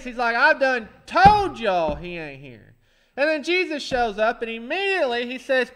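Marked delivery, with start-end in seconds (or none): 0.3-2.7 tag. Retold or imitated. Thomas voiced, smug.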